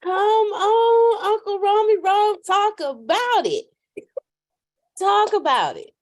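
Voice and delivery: high, loud hype-up call; hype shout. Real, not acted. Cheering Uncle Romy on after his testimony, loud high hype. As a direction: cheer him on like church.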